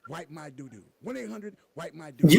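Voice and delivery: mock ad hotline read; hammed-up TV announcer. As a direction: punch out the phone number like a commercial. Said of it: "I'm gonna do my T V voice".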